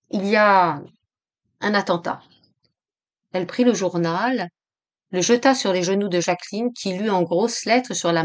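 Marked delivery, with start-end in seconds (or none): 0.1-2.2 toneless voice. A narrator's reading. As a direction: let the news out without expression. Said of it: "d'une voix sans timbre". (d'une voix sans timbre)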